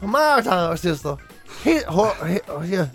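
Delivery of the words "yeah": ordinary delivery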